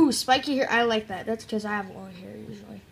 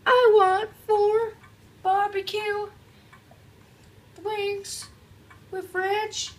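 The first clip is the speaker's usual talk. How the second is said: much higher, slow customer voice; 10 semitones higher, 3 dB louder, slower than usual. Acted. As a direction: order like a squeaky customer. Voices customer ordering wings.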